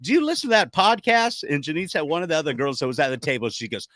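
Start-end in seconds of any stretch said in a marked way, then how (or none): 0.0-1.4 higher, louder eager question; curious stranger. Retold or imitated. Co-host voicing Maggie asking his wife about the podcast, high and eager. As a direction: ask it like an excited fan. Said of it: "Maggie goes".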